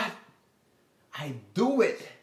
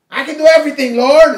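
mock boast; 8 semitones higher, 13 dB louder, faster than usual. Mocking a self-reliant believer.

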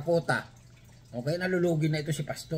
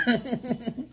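laughing; 8 semitones higher than usual. Real, not acted. Laughing after joke.

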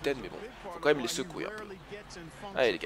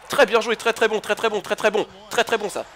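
higher and louder, thrilled praise; excited cheering; 7 semitones higher, 9 dB louder than usual. Real, not acted. Gamer cheering his player, excited.

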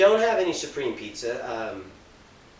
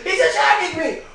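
much higher and louder, frantic, laughing through it; panicked screaming; 8 semitones higher, 8 dB louder than usual. Acted. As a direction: scream for your life. Zombie attack panic.

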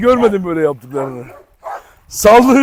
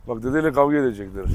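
ordinary talk, nothing marked

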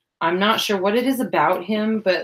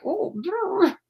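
high squeaky brr like she's freezing; mock shiver; 12 semitones higher than usual. Talking about the cold, a mock shivery brr. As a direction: act out shivering at seventy degrees. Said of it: "I start, like".

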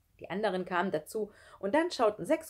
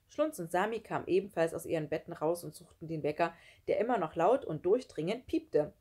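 plain reading voice

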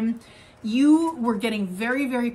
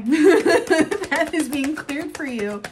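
laughing; 5 semitones higher, 6 dB louder, much slower than usual. Real, not acted. Laughing repeat of her reading.

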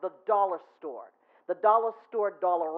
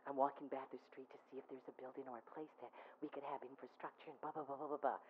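much softer, lower, mumbled answer; quiet, bored self-reply; muted reply; 7 semitones lower, 19 dB quieter, faster than usual. Retold or imitated. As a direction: trail off into blah blah. Narrator's own reply, muted.

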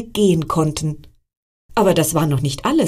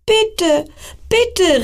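begging call; 14 semitones higher than usual. Acted. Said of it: "rief der einsame Stern".

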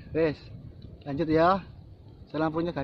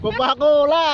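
excited shout; 7 semitones higher, 10 dB louder, slower than usual. Real, not acted. Excited shout of drink name.

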